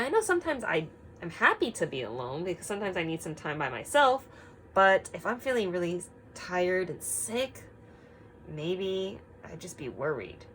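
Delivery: ordinary delivery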